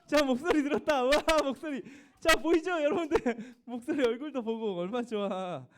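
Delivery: loud, high, delighted exclamations; excited. Real, not acted. Teacher thrilled to hear a student's voice on the call, loud and high. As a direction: cheer that a student finally answered.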